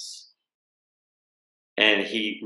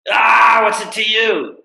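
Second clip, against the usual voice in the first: high, loud belligerent yell; drunk's hostile shout; 14 semitones higher, 9 dB louder than usual. Retold or imitated. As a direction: bark it back at the old man. Drunk snaps at old man.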